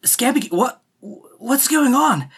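puzzled, halting questions; confused Walt. Acted. Walt confused by transformed pet.

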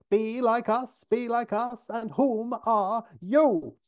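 high, mounting mock shout; losing it, shouting. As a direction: act an aggressive outburst, dragging out the end. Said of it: "Six finally loses it and shouts at her".

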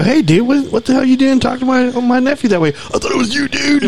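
imitating a caller in a very high voice; high-pitched mock voice. Uncle confronting friend about talking to his nephew, high-pitched. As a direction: push the whole quote way up high. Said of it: "Why does he sound like that".

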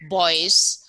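ordinary delivery

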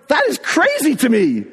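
high-pitched, emphatic disbelief; can't get over it; amazed. Retold or imitated. Husband astounded by his wife's faith, amazed.

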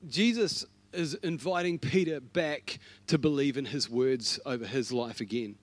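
ordinary talk

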